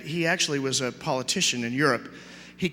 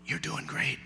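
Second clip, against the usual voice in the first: whispered encouragement; 2 semitones lower, 9 dB quieter, slower than usual. Acted. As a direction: gently urge a child on, close to his ear. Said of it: "he says to the little boy".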